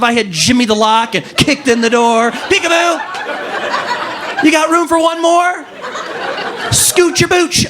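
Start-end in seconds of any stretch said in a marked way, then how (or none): 2.3-7.7 high-pitched, silly peekaboo greeting; playful mock intruder. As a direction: burst in with hammed-up, high cheeky calls.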